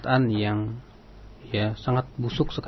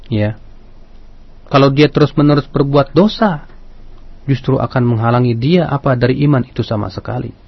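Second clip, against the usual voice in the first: preaching; 10 dB louder than usual. Acted. Radio sermon delivery.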